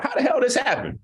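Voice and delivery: laughs through the question; high, laughing disbelief. Real, not acted. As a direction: crack up at the sudden turn. Laughing at his own joke.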